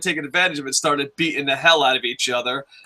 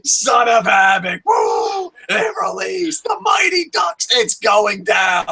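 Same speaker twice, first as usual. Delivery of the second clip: excited fan cheering; 8 semitones higher, slower than usual. Retold or imitated. Fan cheering Son of Havoc, high excited shouts. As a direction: scream it like you're in the front row.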